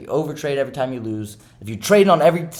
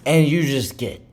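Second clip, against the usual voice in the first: usual speaking voice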